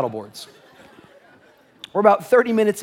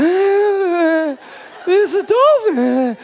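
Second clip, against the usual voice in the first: giddy mock gushing; 4 dB louder than usual. Pastor mocking his own relief at the dolphin, giddy high gushing. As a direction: coo over the dolphin like a new best friend.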